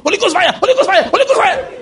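mock bold shout ending in a laugh; fiery prayer shout. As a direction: cast them out with a rising shout. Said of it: "and you go".

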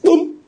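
high-pitched mock "boom"; sudden high sound effect for a crack; imitated boom.

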